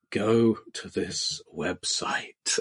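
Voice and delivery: soft, slow, quavering whisper; creepy whisper. Retold or imitated. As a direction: whisper it slowly and shakily. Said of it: "quavering voice whispered in my ear".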